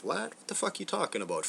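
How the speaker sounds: sharp high "What?" then a fast incredulous rush; mock disbelief. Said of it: "And everyone's like".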